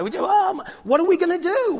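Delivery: high, loud fretting; mock panic. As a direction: act out a worried reaction. Acts out panic at America falling.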